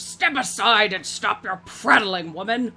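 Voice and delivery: a hint of a barking old warrior; gruff old orc. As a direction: brush her aside with a loud command. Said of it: "steps forward and goes".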